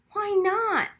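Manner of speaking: sharp, high demanding question. A narrator's reading. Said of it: "I demanded".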